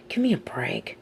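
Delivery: whispered, mocking disbelief; scoffing whisper. Villain scoffing under his breath at the captive's story, whispered. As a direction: breathe it out with a sneer.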